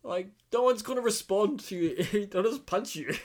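high, loud, laughing exasperation; laughing disbelief. Real, not acted. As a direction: laugh at how silly it is. Mocking friend's silly idea.

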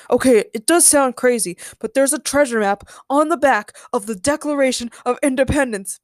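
loud, punched-out insistence; emphatic. A narrator's reading. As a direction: stress each phrase to convince them. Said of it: "Ben said emphatically".

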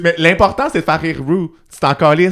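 loud and high; heated. Real, not acted. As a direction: argue it with heat. Heated opinion.

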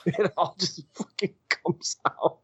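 high, drawn-out words through laughter; laughter breaking up the words; laughing. Real, not acted. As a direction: laugh your way slowly through the line. Laughing while recalling breakdown.

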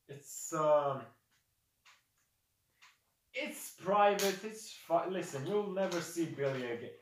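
high, loud, flustered excuses; evasive orc. Acted. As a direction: dodge the question and hurry your beast away. Orc dodges question, hurries Billy away.

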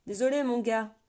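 loud call from up on the wall; sentry calling from above. A narrator's reading. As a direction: shout it down from the palisade. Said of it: "répondit aussitôt une voix en hauteur".

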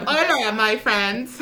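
bright mock Dora greeting, slow; playful kids' show host; Dora the Explorer voice. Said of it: "Somos Dora la Exploradora en este momento".

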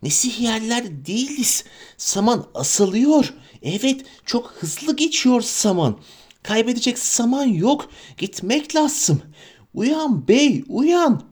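high, slow wheedling creature; urgent, sly creature pleading; Gollum voice. Acted. Gollum urging Frodo.

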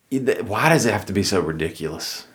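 normal talking voice

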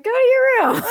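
high, loud mock yell breaking into laughter; stern order cracking up. Pretend mom sends kid off while laughing. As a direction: try to scold but crack up. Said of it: "I'd probably start laughing".

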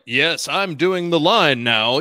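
very slow, stiff actor delivery; wooden line reading. Retold or imitated. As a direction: read it like a bad actor reciting. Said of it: "it's like".

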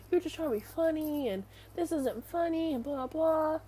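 high-pitched mock indignation; retold yelling without real volume; imitated angry rant. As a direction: mimic someone going off, pitched well up. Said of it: "like, yelling at the one who looked it up".

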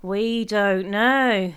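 very slow, loud drawn-out answer; dutiful chorus. A narrator's reading. Family humouring Mum's joke, chorusing. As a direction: drag out each word in mock chorus. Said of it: "we dutifully chorused".

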